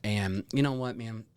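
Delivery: usual speaking voice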